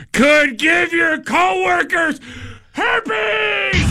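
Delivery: high, slow shouted doom; horror trailer voice. Pretend. Mock dramatic warning.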